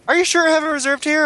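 high, mocking clerk voice. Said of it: "they're like".

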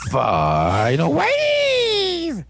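very slow, high, stretched-out cry; mock attack call. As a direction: draw out each word like a finishing move. Imitates the heroes' attack call.

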